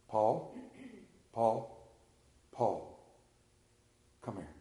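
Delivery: low, soft, spaced-out calling; gentle call. Acted. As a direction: call a lost man quietly by name. Jesus gently calling the preacher.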